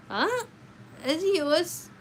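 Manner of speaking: a sharp, high confused huh; surprised huh. Real, not acted. Streamer baffled by a chat message, high surprised huh. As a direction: caught off guard by what the chat said.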